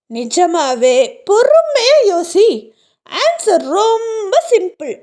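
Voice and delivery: high, eerie voice mocking his confusion; taunting ghost. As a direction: tease him from beyond, voice lifted and strange. (கேலி செய்யும் பேய்க் குரல்)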